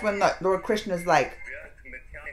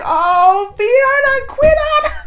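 high, loud gasp of surprise; surprised; 12 dB louder, slower than usual. Real, not acted. Viewer reacting as Vidar quits on the king. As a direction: cry out in delighted shock. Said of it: "I was like".

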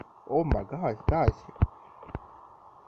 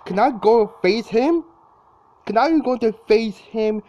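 high-pitched, louder anxious question; nervous excited pleading; 8 semitones higher, 5 dB louder than usual. Real, not acted. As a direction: voice climbing with nerves at the boss. Nervous gamer facing a Titan.

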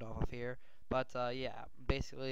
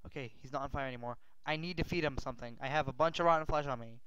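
ordinary delivery

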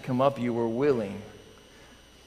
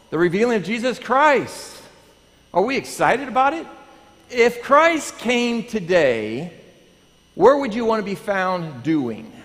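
higher, louder, excited preaching; preaching with fire; 8 semitones higher, 6 dB louder than usual. Acted. Pastor rousing the congregation about Christ's return.

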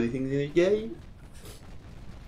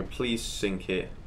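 pleading under breath; 8 dB quieter, slower than usual. Real, not acted. Begging the enemy ship to sink.

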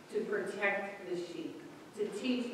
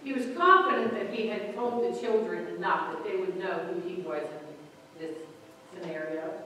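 ordinary talk, nothing marked

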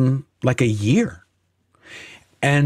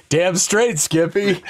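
cocky taunt; 7 semitones higher than usual. Retold or imitated. Retold taunt while carried out.

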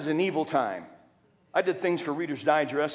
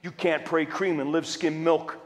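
ordinary talk

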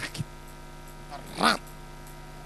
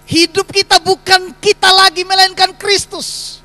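fervent proclamation; 10 semitones higher, 10 dB louder than usual. Real, not acted. Pastor proclaims to congregation.

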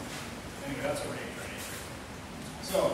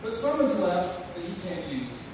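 poem recited in a cowboy voice; cowboy drawl; 5 semitones higher, 4 dB louder than usual. Acted. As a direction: recite it like a cowpoke at the campfire.

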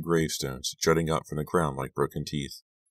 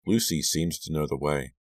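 usual speaking voice

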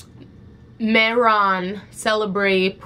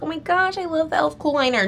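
higher, airy parody of a gushing fan; mocking gushing fans; 8 semitones higher than usual. Mocking fans who love the elf liner, in a lightly gushing parody voice. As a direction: mimic everyone who raves about it, lightly. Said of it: "Everyone's like".

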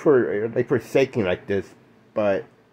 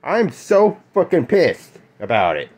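shaking with anger; 5 semitones higher than usual. Real, not acted. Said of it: "why I'm shaking like this".

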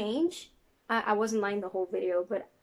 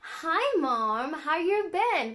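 light imitation of an American accent, higher, slow on the end; 5 semitones higher, much slower than usual. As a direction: greet mom in a breezy American accent. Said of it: "'Cause in America".

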